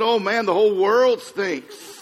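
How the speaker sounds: loud, high, drawn-out mock complaint; hammed-up disgusted holler; exasperated grandpa outcry. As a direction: wail it to the whole world, comically fed up. Grandpa's escalating disgust in joke.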